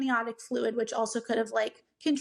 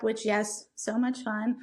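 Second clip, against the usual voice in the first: ordinary delivery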